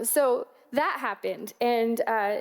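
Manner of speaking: ordinary talk, nothing marked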